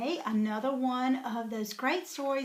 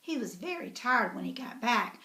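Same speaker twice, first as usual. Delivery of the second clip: usual speaking voice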